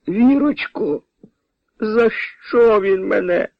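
high, drawn-out plaintive whine; pitiful whining. Acted. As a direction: whimper to your wife for sympathy. Beaten husband complains to his wife.

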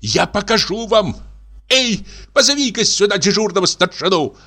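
high, strained official summoning help; a bank director threatening the rowdy guest; indignant bank director. Acted.

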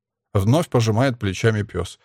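ordinary delivery

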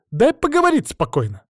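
высоко и громко, с раздражением; прикрикни в сердцах; раздражённый крик. Acted. Жрица кричит на дракона. (громкий раздражённый крик)

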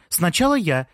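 high-pitched insistent protest; urgent cry to be first; shouted. A narrator's reading. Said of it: "закричала Джанна".

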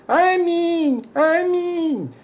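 high, ringing shouted amen; shouting at the top of his lungs. Acted. Johnny yells while running round the graves, full shout. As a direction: bellow it running across the cemetery. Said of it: "во всё горло кричал".

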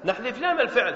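ordinary talk

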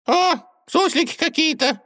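high squeaky gopher muttering; puzzled squeaky gopher; squeaky mumble. A narrator's reading. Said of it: "пробормотал бывший серый волк".

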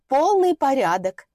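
butter up the landlady; fawning. Acted. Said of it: "угодливым голосом".

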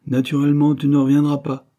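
a friend insisting loudly; commanding. Acted. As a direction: order him not to come back. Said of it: "commanda Henry".

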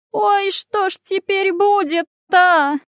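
high, slow, drawn-out lament; keening, stretched-out cry; wailing peasant woman. A narrator's reading. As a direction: wail it like a village woman in despair. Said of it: "заголосила баба, заламывая руки".

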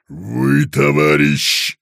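bang it out like a dented pot; clanging pot voice. A narrator's reading. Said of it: "оглушая Короткова кастрюльными звуками".

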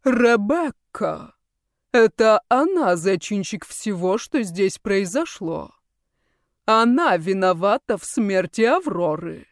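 slow venom-dripping villain; venomous voice. Acted. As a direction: pour poison into every word. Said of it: "голос его стал источать яд".

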